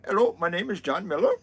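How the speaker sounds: mock Indian call center accent. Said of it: "thick Indian accents like that".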